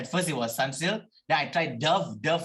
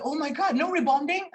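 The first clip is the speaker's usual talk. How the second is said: put-on much higher amazed girl voice; mock girl amazed; 13 semitones higher than usual. Retelling a girl stunned by his hair, high amazed. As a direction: play the stunned stranger.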